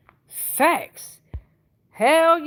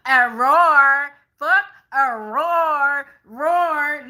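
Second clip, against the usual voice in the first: a playful growled roar for the Leos; mock roar; 2 semitones higher, 7 dB louder, much slower than usual. Tarot reader roaring for the Leo viewers, hammed-up. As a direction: roar it out big and slow, half joking.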